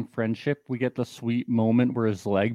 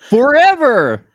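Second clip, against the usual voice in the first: loud; 12 semitones higher, 12 dB louder, slower than usual. Pretend. Holding up sign dramatically.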